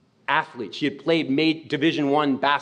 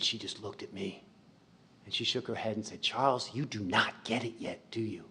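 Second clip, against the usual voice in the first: quiet reproach; 4 semitones lower, 12 dB quieter than usual. Retold or imitated. Miss Pat gently scolding the boy who cursed her, quietly.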